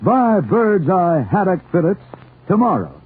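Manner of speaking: normal talking voice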